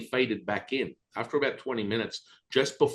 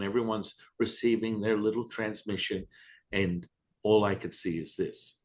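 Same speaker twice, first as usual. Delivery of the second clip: ordinary delivery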